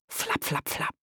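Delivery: soft, voiceless flapping sound; leise nachgeahmtes Flattern. A narrator's reading. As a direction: whisper a quick flap of wings. Geräusch beim Lauschen. (leises, gehauchtes Flattergeräusch)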